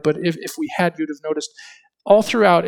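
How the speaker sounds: usual speaking voice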